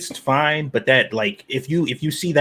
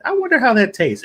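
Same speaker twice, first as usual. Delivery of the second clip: mock curious; 11 semitones higher, 4 dB louder than usual. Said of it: "And I'm like".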